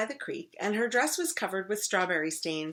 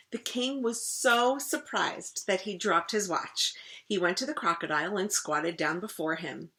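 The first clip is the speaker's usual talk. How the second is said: usual speaking voice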